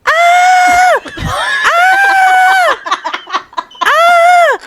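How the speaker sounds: shrill high startled screams, very high pitch; old lady scream. Retold or imitated. Auntie sprayed by the hose, screaming high. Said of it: "it's exactly like that tone".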